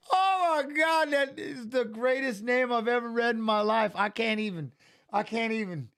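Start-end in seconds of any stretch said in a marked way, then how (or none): 0.0-3.9 high-pitched helpless laughter through the words; can barely speak for laughing; real tears-of-laughter squeal; crying laughing. Real, not acted. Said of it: "I'm crying. I got tears in my eyes".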